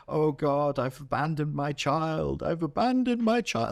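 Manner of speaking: high, pleading wail of a guilty parent; mock anguish. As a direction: overdo the guilt, rising in pitch.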